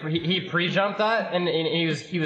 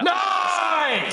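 excited shout. Real, not acted. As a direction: scream it after scoring. Goal celebration.

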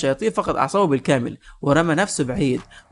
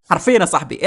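ordinary delivery

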